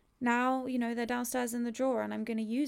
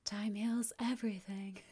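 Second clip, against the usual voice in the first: breathy sarcastic hush; mock whisper of a tired cliché; 3 dB quieter than usual. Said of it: "it's just the worst one".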